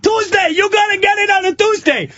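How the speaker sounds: very high mock shout; shouted parting threat. Pizza man walking away threatens neighbor, high-pitched parting yell. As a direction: imitate a man yelling threats as he walks off. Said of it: "He starts walking away like".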